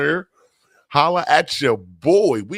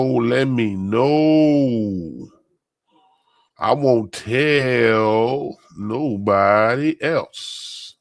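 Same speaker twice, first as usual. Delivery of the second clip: slow drawn-out; 3 dB louder, much slower than usual. Acted. Playful secret-keeping drawl.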